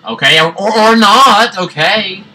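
high loud surprised outburst; startled yelp. Real, not acted. Gamer reacts to the level going wrong with a startled yelp. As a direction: jump at the sudden turn.

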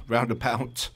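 a light London accent on roundabout, said as "roundabout" with a laugh; a quick, understated London twang on roundabout. Shown as a demonstration. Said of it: "That's my London accent".

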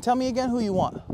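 high, challenging demand; taunting Jesus. Retold or imitated. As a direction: throw it down as a challenge. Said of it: "Jesus is still going".

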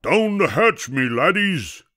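high captain's call, dropping at the end; hearty storybook pirate yell. A narrator's reading. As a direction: yell it to the crew. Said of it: "pounded his fist on the table and yelled".

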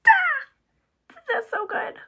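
high breathy ah, then a whispered so good; delighted sigh. Real, not acted. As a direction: swoon over it, then whisper. Delighted at the brush result.

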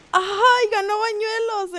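high, loud drawn-out exclamation; thrilled high-pitched winner call; excited victory cry. Real, not acted. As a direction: cheer the winner, stretching it out. Announcing the winner.

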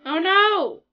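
loud, high wail of alarm; dismayed cry. Real, not acted. Player fearing she'll miss New Year's, dismayed cry. Game glitch threatens missing event.

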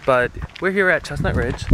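normal talking voice

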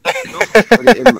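shouted slowly; loud slow demand. Acted. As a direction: drag it out loudly.

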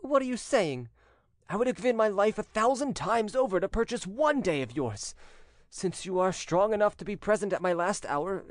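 high-pitched, fervent avowal; deeply touched son. A narrator's reading. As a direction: protest with loving fervour.

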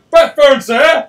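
loud, high "bat phone"; shouted announcement. Retold or imitated. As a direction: belt it out like a butler. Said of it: "He was like".

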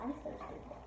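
whispering to herself; breathy whisper of self-blame. Real, not acted. Self-reproach after burn.

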